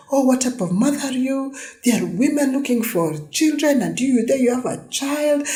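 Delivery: mocking high-pitched scold from the online crowd; high, scornful mock scolding of a tired mother; scolding critics. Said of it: "everybody, and mostly women".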